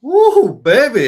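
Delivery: high, loud whooping cheer; big hyped-up holler; excited whoop. Real, not acted. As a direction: whoop it up for the crowd. Host hypes up the speaker.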